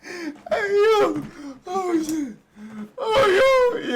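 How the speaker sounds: very high, squealed through laughter; helpless laughter. Real, not acted. Viewer cracking up at the story, helpless laughter. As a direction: gasp out words while cracking up.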